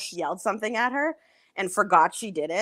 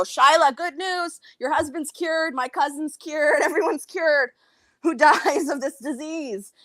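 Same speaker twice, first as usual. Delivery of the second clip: retold yell; 7 semitones higher than usual. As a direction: call out sarcastic good news, pitched up. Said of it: "And then I yell".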